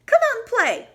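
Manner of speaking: high, loud call across the field. Acted. Mouse calling Bear out of the woods, high and loud. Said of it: "called Mouse".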